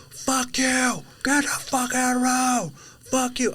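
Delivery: high, strained imitation of a yeller; hammed-up high-pitched road-rage yell; mock angry yelling. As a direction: imitate a furious driver yelling from afar. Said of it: "who's fucking yelling out here".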